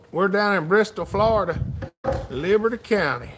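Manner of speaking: ordinary talk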